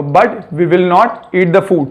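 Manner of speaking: said very slowly, word by word; slow demo. Shown as a demonstration. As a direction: model it slowly for students. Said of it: "teacher models the sentence". (धीरे-धीरे साफ़ बोलना)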